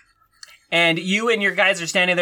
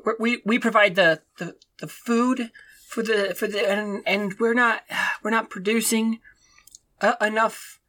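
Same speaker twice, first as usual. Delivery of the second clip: stammering, halting peasant pleading with a lord; nervous stammering farmer. Acted. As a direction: stutter through it, scared to ask.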